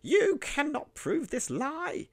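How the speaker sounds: high, loud, slow snarl; snarled denial. A narrator's reading. Cornered miser snarling at Kane, high, loud and slow. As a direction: spit it out slowly, high and loud. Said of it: "Ezra cringed and snarled".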